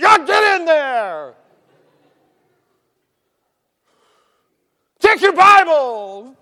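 high, drawn-out holler; shouted command. Retold or imitated. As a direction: bellow the order slowly, stretching the words. Acts out staff shouting orders.

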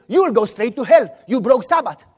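loud, stern condemning voice; mock scolding. Church member catching a boy with an apple, scolding.

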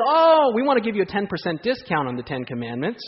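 mocking sales-pitch voice rising high at the end; mock salesman offer. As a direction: sell it like a cheesy deal. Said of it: "But they say".

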